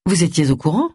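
plain reading voice

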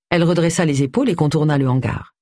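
ordinary delivery, nothing marked

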